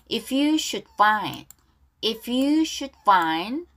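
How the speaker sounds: slow, careful repeat-after-me English; measured read-along for learners; pronunciation model. Shown as a demonstration. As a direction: say each phrase deliberately, then repeat it. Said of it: "발음 따라할게요".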